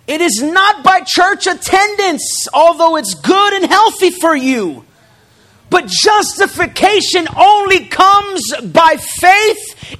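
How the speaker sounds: loud, rising preacher's call; preaching at full force. Acted. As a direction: drive the point home to the congregation.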